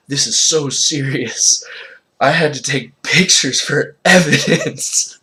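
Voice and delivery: laughing mock yell; giggle yelling. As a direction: yell while trying not to laugh. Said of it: "there was laughter in her voice".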